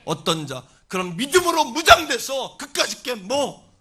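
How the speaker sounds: loud, forceful sermon delivery; full-force preaching. Real, not acted. Preacher warning the congregation of the devil's attack, full-force preaching. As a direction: preach it hard, driving each phrase.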